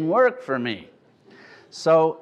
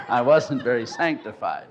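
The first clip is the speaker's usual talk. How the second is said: ordinary talk, nothing marked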